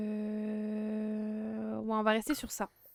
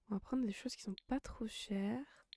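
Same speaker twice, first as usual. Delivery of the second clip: whispering; 9 dB quieter than usual. Real, not acted. Quiet murmur while shopping.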